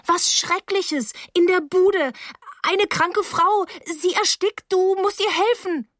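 put-on desperate girl raising the alarm; panicked plea. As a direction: beg for help in a high, frantic voice. Frantic girl begs for help.

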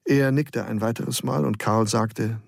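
usual speaking voice

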